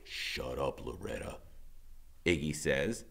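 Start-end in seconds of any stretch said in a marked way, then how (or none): 0.0-1.4 lower, softer, cold; low menacing. A narrator's reading. Iggy silencing Loretta, low and cold. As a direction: cut her off in a low growl.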